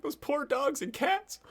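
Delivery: high, slow fake sob; hammed-up weepy wail; mock crying. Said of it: "pretend to cry".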